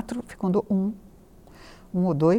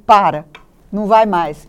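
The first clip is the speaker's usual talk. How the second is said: loud, blunt command to quit; firm order to stop; 8 dB louder than usual. Retold or imitated. Psychoanalyst ordering patient to stop trying, loud and blunt. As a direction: tell her flatly to give up. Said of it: "pra um psicanalista que é travado".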